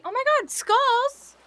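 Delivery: high, loud burst of delight; sudden high-pitched cheer at the screen; excited squeal. Real, not acted. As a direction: yelp it out, thrilled. Reacting to stream alert.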